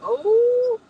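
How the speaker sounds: high squeal of realization; sudden high excited oh. Real, not acted. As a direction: shriek it as it hits you. Sudden shriek of recall.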